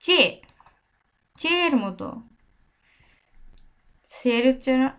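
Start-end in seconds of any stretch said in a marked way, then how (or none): 0.0-2.1 「চে」 said as Bengali che; Spanish che. Shown as a demonstration. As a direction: say 「চে」 with a sharp ch sound. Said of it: "সে আচের উচ্চারণ কেমন".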